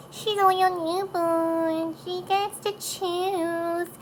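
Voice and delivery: put-on high, drawn-out baby talk; baby's voice. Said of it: "that's my four-month-old's voice".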